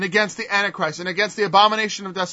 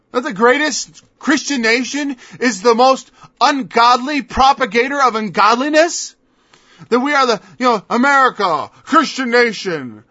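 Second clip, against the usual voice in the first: normal talking voice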